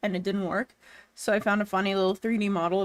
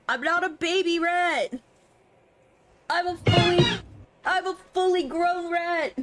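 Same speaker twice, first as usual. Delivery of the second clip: higher, indignant little insist; indignant protest; 6 semitones higher than usual. Real, not acted. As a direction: insist you are grown, offended.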